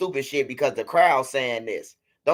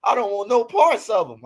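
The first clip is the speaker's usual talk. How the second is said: begging fan; 6 semitones higher, 5 dB louder than usual. Retold or imitated. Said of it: "Tell them".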